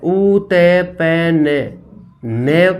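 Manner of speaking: very slow letter by letter; spelling out. Shown as a demonstration. Teacher spells the word for students, very slowly. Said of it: "spelling out letters".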